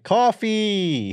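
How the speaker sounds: high, loud laughing vendor call; casino drink hawker. Retold or imitated. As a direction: hawk drinks like a ballpark seller. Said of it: "it's just them like".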